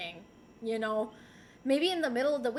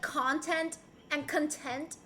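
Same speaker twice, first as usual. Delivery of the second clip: first "content" as CON-tent, second "content" as con-TENT; contrasting stress in CONTENT; 5 dB quieter, slower than usual. Shown as a demonstration. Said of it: "if they're pronounced differently".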